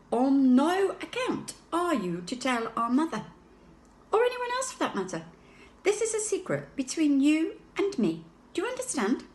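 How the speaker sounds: a hint of a stern, secretive older voice; lightly voiced baby George. A narrator's reading. Baby brother warning his sister, mildly stern.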